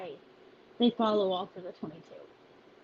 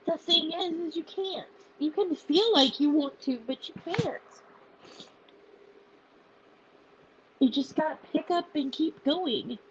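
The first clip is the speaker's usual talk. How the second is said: tearful, higher voice urging them on; real tearful voice; 5 semitones higher than usual. Real, not acted. Founder telling followers to keep going, tearful. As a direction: push on through the tears. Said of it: "I am an emotional wreck".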